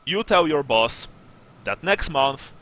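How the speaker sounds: emphatic, no-nonsense ultimatum; stern sergeant. Acted. Sergeant demands fifty gold crowns from smugglers, stern. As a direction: lay down terms, pressing each point. Sergeant sets new price.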